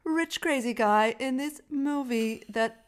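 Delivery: high, loud, slow mock pomposity; mock villain speech. Mocking villain's first scene, slow and high. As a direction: ham up the weird rich guy. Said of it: "when he was like".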